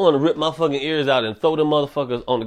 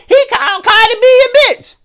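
squeaky, much higher, louder tattletale; mock offended listener; 8 dB louder than usual. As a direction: whine it like a scandalized fan. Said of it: "So don't be like".

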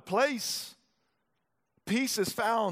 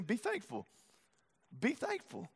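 softer be thankful, almost breathed; hushed exhortation; 9 dB quieter than usual. Acted. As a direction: drop to a hush as you urge them. Repeated as a soft exhortation.

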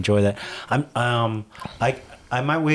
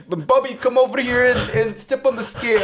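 Filipino relative; 12 semitones higher than usual. Retold or imitated. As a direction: boss the kid onto the scale like a fussy relative. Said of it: "so you're like".

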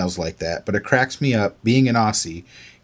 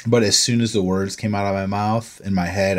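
ordinary talk, nothing marked

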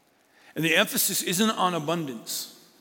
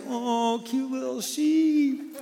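higher, slow cooing at cute sheep; cooing over sheep; 9 semitones higher, much slower than usual. Retold or imitated. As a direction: gush like you're seeing a lamb. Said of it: "you're like".

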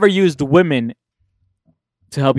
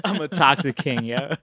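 said through a laugh; 4 dB quieter than usual. Real, not acted. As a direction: laugh the boast out. Laughing while he says it.

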